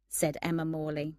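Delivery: ordinary delivery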